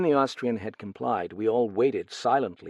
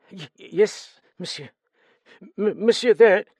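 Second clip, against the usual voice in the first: stammering, higher, louder frightened servant; nervous stammer; 10 semitones higher, 9 dB louder than usual. Acted. Steward questioned about the murder, nervous stammer. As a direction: stumble over the words in fright. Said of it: "he nodded nervously".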